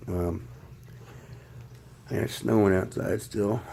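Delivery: usual speaking voice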